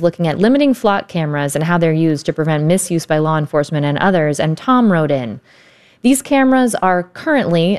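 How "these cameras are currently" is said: "currently" slowed and leaned on, starting high; stress on currently. Retold or imitated. Host reading out Tom's email on flock cameras, stressing "currently". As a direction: read it out slowly with emphasis on "currently". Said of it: "emphasis on the word currently".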